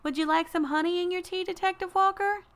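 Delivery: put-on high sweet little-girl voice. A narrator's reading. Girl offering honey to detective, high child voice. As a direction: play the tiny hostess, high and polite. Seven-year-old girl hosting tea party.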